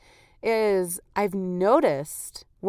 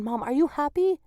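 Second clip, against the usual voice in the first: imitated toddler question; 6 semitones higher than usual. Voicing her son interrupting her.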